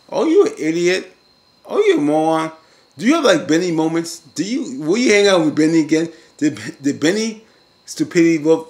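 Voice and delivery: loud, high-pitched incredulous mock rant; exasperated scolding. Recapper scolds Jeffrey for showing up in court, exasperated. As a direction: chew him out in exaggerated disbelief.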